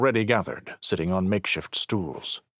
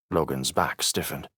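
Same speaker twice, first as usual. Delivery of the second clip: ordinary delivery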